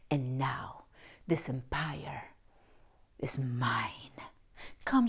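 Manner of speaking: slow breathy villain whisper; scheming whisper. Retold or imitated. American soap character gloating over the empire, slow whisper. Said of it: "the main character says things like".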